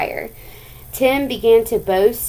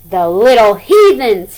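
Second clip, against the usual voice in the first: much louder angry shout; furious loud shout; 10 dB louder than usual. Acted. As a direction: yell it out in a rage. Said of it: "he shouted".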